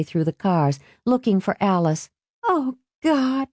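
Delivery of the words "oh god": put-on high-pitched girlish groan. A narrator's reading. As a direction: groan in dread at who's coming. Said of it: "Alice groaned with his approach".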